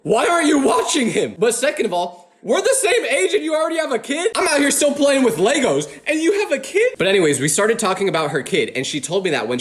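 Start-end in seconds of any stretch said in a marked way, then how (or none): 0.0-6.9 incredulous. Real, not acted. Shocked by same-age mom.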